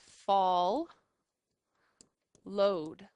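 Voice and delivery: teacher sounding out fall, load; split into syllables. Shown as a demonstration. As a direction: fall then load with a long pause between. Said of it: "break up the double letters".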